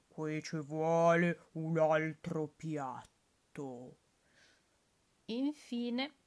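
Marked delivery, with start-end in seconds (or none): 0.1-4.0 wolf musing. Acted.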